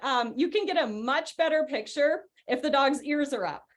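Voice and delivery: ordinary talk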